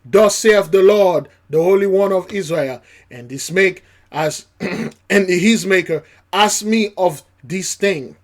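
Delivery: loud, ringing scripture reading; preaching scripture. Acted. Preacher booming scripture to congregation, loud. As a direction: proclaim it from the pulpit.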